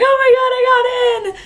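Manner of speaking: repeating the news to every relative; slow thrilled squeal. Retold or imitated. Calling family, same reaction.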